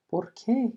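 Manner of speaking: high rising ¿Por qué?; ¿Por qué? asked with a high questioning rise; questioning tune. Shown as a demonstration. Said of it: "Por ejemplo".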